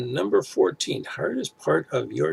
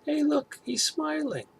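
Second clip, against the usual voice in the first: much higher, teasing mock voice; mock commenter voice; slower than usual. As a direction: squeal it like an amused onlooker. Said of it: "somebody said".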